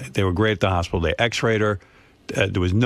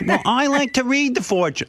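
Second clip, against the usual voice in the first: much higher, sing-song mother voice; imitating mother; 14 semitones higher than usual. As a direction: innocent mother defending herself. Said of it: "my mother goes".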